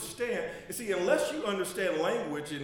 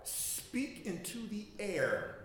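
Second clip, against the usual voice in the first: drawn out; 4 semitones higher, 6 dB quieter, much slower than usual. Acted. Preacher drawing out the key phrase.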